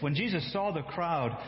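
ordinary talk, nothing marked

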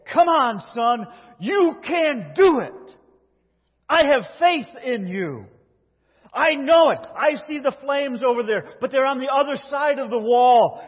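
loud, high, driving encouragement from outside the fire; father's urgent shout. Retold or imitated. Father shouting his son through the flames, loud urgent cheer. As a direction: yell him forward, step by step.

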